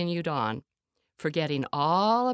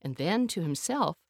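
usual speaking voice

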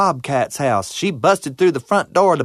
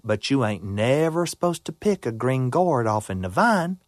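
usual speaking voice

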